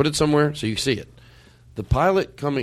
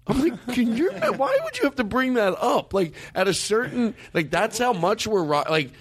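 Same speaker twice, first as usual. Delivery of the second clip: high-pitched incredulous rant; can't believe he said that; exasperated; 9 semitones higher than usual. Real, not acted. Exasperated rant about pilot.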